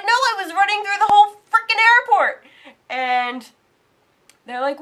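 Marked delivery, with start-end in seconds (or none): 0.0-2.4 loud, high, frantic protest; exasperated shout. Retold or imitated. She shouts at the gate agent, frantic and high. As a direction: shout it out of breath and fed up.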